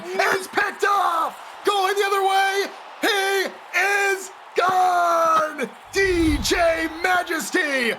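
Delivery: shouted high touchdown call. Real, not acted. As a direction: scream it as he breaks away. Interception return call.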